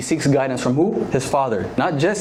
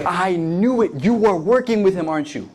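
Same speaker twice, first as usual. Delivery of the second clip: furious Saul; 6 semitones higher than usual. Acted. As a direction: spit out the curse at his son. Saul loses his mind.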